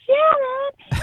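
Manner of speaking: very high, stretched name in delight; thrilled recognition. Retold or imitated. Patient realizing she knows Shannon, thrilled and high. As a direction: gasp the name in sudden excitement. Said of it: "she's like".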